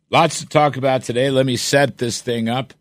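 ordinary talk, nothing marked

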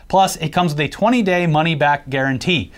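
ordinary talk

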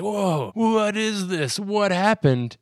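high, drawn-out disbelief; mock astonishment. Bum seeing himself in the mirror, high astonished voice.

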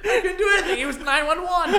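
mock him while laughing hard. Real, not acted.

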